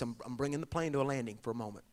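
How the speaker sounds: normal talking voice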